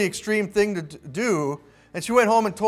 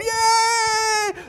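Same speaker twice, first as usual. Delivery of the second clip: a long, very high gleeful yay; overjoyed cheer. Retold or imitated. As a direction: cheer like a delighted child. Kid freaks out with joy.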